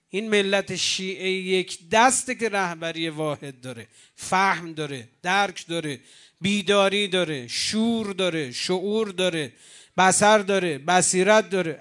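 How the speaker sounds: preacher building the list to full force; rising preaching fervor. Acted. Preacher rousing the crowd, building to a loud fervent climax. Sermon crescendo listing virtues.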